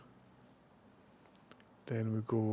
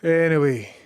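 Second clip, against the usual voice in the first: emphatic anyway; 4 semitones higher, 6 dB louder than usual. Pretend. Streamer dropping a topic, loud emphatic anyway. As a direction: announce it to change the subject.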